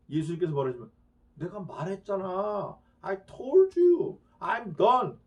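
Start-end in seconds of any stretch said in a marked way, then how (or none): 1.3-5.2 weary Jesus. Acted. Jesus answering the crowd.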